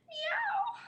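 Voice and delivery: very high frightened meow. Acted. Reader voicing the frightened cat, very high meow. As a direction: mew like a shivering cat.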